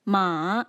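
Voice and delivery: "Ma" loud, dipping and then rising; third tone. Shown as a demonstration. Teacher leads repeat-after-me drill, "Ma" falling then rising. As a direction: let "Ma" fall, then bend back up. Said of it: "using the five tones".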